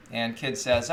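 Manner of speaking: ordinary talk, nothing marked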